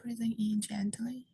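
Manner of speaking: soft, unhurried meditation guidance with long pauses; guided meditation. Real, not acted. Teacher guides seated group to relax the body, soft meditation voice. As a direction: settle them gently, leaving long silences between phrases.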